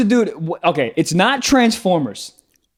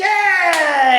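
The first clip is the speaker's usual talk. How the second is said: high, drawn-out victory shout; real elation at a right guess; triumphant cheer; 10 semitones higher than usual. Real, not acted. Celebrates correct guess in game.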